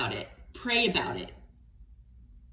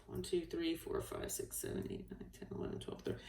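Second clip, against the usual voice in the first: near-whispered count; barely audible, private tally; counting under breath; 3 semitones lower, 16 dB quieter than usual. Real, not acted. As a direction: count very softly to yourself. Counting cards to herself.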